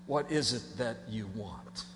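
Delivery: low, soft and slow; preacher quietly asking the congregation; soft question. Real, not acted. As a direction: ask it gently to each listener.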